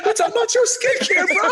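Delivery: talking through laughter; laughing. Real, not acted. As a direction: laugh while pressing him. Friends laughing at answer.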